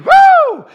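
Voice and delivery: very high, loud woo; mock church whoop; joyful whoop. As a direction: let out a revival whoop. Imitating the woman's shout.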